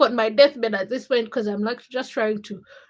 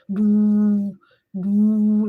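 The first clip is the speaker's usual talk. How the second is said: loud, droning music noise; imitated music; 6 dB louder than usual. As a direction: hum out the loud music as long held notes. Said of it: "that's the, the, the kind of music".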